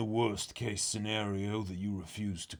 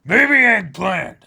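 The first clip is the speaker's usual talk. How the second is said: much louder, higher taunt; loud teasing call; 11 semitones higher, 17 dB louder than usual. Acted. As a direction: belt the nickname out.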